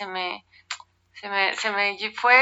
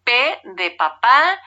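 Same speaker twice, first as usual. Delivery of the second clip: spelling it out slowly; 3 semitones higher, much slower than usual. Shown as a demonstration. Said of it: "Siempre he sido malísima cuando te lo piden las telefonistas".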